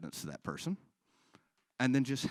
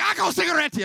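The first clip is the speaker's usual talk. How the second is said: high, loud angry Slovak barking; angry old Slovak woman; 11 dB louder than usual. Retold or imitated. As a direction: snap at the customer like an old shop lady. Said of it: "She's like".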